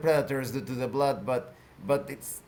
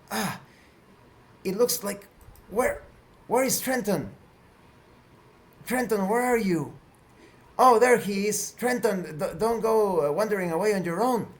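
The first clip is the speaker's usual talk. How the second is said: higher-pitched companion noticing someone is missing; worried exclamation; 5 semitones higher than usual. Acted. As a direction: call out, then scold the wanderer lightly. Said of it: "Arisa exclaims".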